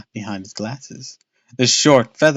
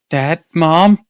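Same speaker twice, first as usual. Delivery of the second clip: frightened call; 6 semitones higher, 6 dB louder than usual. A narrator's reading. Frightened girl calling out.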